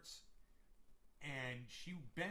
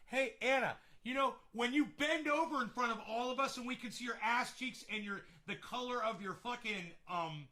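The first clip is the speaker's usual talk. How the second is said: higher, louder teasing call-out; joking colleague voice; 8 semitones higher, 7 dB louder than usual. Retold or imitated. Colleague teasing Anna about her skirt, humorous and loud. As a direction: rib her playfully in front of the office. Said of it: "should have been done like".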